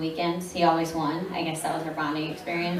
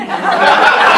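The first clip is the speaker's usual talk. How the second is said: burst of laughter; 10 semitones higher, 14 dB louder than usual. Real, not acted. Laughing at her own confusion.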